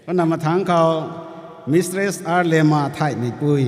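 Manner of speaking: formal roll-call announcement; calling out names. Real, not acted. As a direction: call the next name out clearly.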